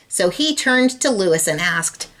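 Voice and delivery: ordinary delivery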